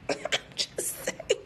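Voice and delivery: breathless whispered laugh. Real, not acted. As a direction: say it through quiet laughter. Laughs after wig joke.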